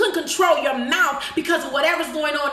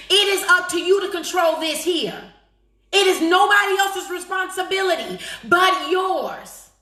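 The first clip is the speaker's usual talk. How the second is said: raised, insistent preacher's pitch; forceful preaching; 3 semitones higher than usual. Real, not acted. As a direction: preach it hard, stretching the key words.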